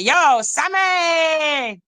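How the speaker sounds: high drawn-out call. Real, not acted. Calling out to Sammy.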